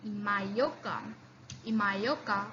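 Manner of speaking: Imayoka said softly with a falling end; calm repeat of Imayoka for students; quiet teacher repetition of Imayoka; model word. Shown as a demonstration. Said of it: "practice saying the examples".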